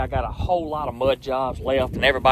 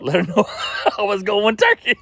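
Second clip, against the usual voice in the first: words broken by real laughter; cracking up mid-sentence; laughing; 7 semitones higher, 5 dB louder than usual. Real, not acted. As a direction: laugh through it, louder. Joking about excuse, laughing.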